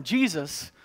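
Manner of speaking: normal talking voice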